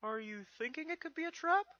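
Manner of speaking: put-on high, loud young dragon voice; Spike's voice. Young dragon asks Twilight about the letter, high and eager.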